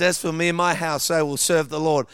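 declaring scripture. Real, not acted. Preacher quoting God's promise to the church, ringing declaration. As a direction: proclaim it with conviction. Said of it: "But God says".

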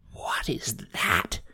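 slow, voiceless whisper. A narrator's reading. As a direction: whisper it slowly to a friend. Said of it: "whispered Bernie to Mona".

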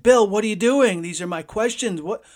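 put-on young broadcaster yelling at his mentor who tore up his questions, furious; flipped out. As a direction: yell at the mentor in disbelief. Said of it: "I flipped out".

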